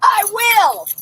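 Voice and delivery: loud, high-pitched yelling; protesting shout. Real, not acted. Detainee objecting to officer's orders, indignant shouting. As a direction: yell back, drawing out the complaint.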